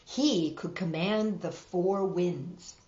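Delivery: ordinary delivery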